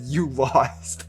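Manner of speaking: mock the loser quietly; cold taunt. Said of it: "He just goes up ... and said".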